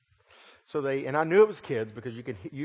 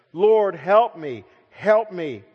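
loud, slow, desperate begging; begging cry; 6 semitones higher, 10 dB louder, much slower than usual. Acted. Kneeling woman begs Jesus for help, loud desperate cry. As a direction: cry out slowly for help, pleading.

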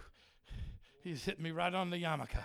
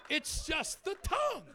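emphatic shout; 11 semitones higher, 3 dB louder than usual. Real, not acted. Preacher stressing a church rule.

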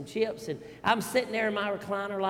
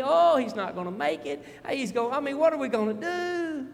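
imitating a fretting wife, higher and rising; worried wife; 7 semitones higher, 5 dB louder than usual. Pastor voicing his wife's worry over their son, higher and anxious. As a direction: fret like a worried mother.

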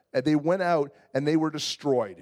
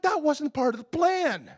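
high, laughing complaint; husband laughing at wife's detour; laughing protest; 8 semitones higher than usual. Retold or imitated. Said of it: "I'm like".

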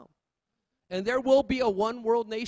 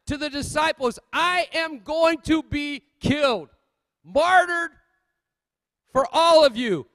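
higher, louder pulpit fire; preaching at full force; 8 semitones higher, 7 dB louder, slower than usual. Real, not acted. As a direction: proclaim it to the whole church.

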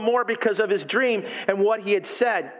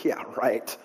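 low, much softer dismissive mutter; scoffing; 10 semitones lower, 5 dB quieter than usual. Retold or imitated. Friends scoffing at your big life ambition, low and dismissive. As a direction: brush the dream off flatly.

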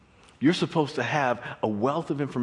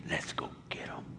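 whispered; 14 dB quieter than usual. Acted. Pharaoh eager to chase Israelites.

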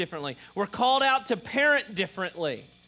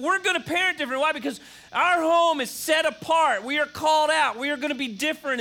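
higher and louder, emphatic; emphatic preaching; 7 semitones higher, 5 dB louder than usual. Real, not acted. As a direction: drive it home with full conviction. Preaching on a set-apart home.

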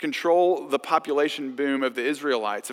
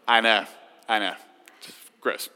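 usual speaking voice

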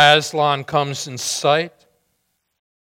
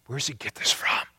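whisper; 3 semitones lower, 9 dB quieter, faster than usual. Real, not acted. Pastor's aside to the congregation.

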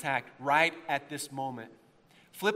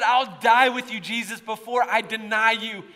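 loud, high bold pledge; 6 semitones higher, 8 dB louder than usual. Acted. Peter pledging himself to Jesus, bold. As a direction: proudly proclaim it with raised voice. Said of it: "he was bold".

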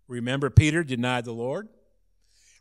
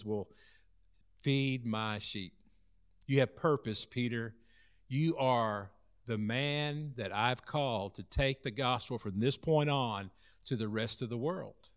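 soft, low, reassuring charge; gentle commissioning; 4 semitones lower, 10 dB quieter than usual. Acted. Jesus giving Peter his purpose, soft and low. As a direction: hand over the task quietly and tenderly.

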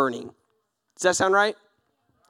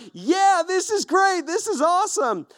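much higher, gushing excitement; hammed-up hype; 11 semitones higher than usual. Believers fired up after a conference, hammed-up hype. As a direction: gush like a pumped-up camper.

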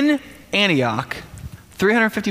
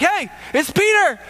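bright call, pitched much higher; calling at the door; 10 semitones higher than usual. Retold or imitated. Peter calls through the door to his friends, much higher. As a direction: sing out your name through a locked door.

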